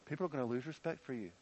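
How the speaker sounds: a hint of quiet, worried pleading; Michal's soft concern. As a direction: voice Michal gently fretting over David. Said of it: "the kind of things Michal says".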